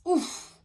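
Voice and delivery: high loud winded grunt; winded oof. A narrator's reading. Bandit boss gasping as he's knocked down, winded. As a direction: get knocked breathless. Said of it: "gasped the bandit boss".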